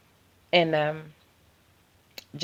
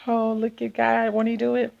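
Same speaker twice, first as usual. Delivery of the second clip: churchy praise; 6 semitones higher than usual. Retold or imitated. Praising God at good numbers.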